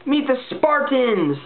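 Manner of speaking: high, slow, hammed-up fan excitement; mock-excited fan shout; mock fan gushing. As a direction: gush like a fan about terrible films. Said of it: "And people are going".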